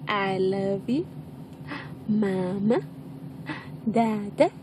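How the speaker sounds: low, drawn-out, each lifting at the end; coaxing model words. Shown as a demonstration. Parent offering baby words to copy, slow coaxing. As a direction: offer each word slowly like a question.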